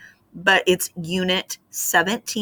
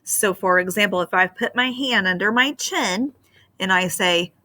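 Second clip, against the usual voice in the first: ordinary talk, nothing marked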